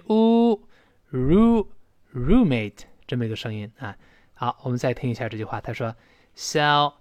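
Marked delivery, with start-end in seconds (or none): 0.1-2.8 roommate built slowly from a long "oo", then "roo"; long u build-up. Shown as a demonstration. Teacher builds the word for learners, roommate with long "oo". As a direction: hold the u, add r, then finish roommate. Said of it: "后面发长音u".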